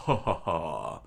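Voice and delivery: loud, spoken-out ha-ha-ha; acted laugh. A narrator's reading. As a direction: put on a laugh for the character. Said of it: "Zolo laughed".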